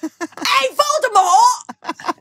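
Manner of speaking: very high, slow, laughing Cockney hail; mock Cockney shout. Harry hailing Voldemort in mock Cockney. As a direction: call Voldemort like a London lad. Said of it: "more realistic".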